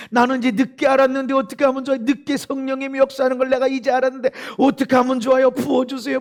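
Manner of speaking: loud, fast, high pleading to God; pleading prayer. Retold or imitated. Preacher crying out to God for the Spirit, pleading. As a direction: beg God to pour out the Spirit.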